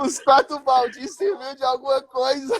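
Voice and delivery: high-pitched, through laughter; laughing punchline. Real, not acted. Storyteller sums up the bribed heckler, laughing. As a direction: deliver the punchline while cracking up.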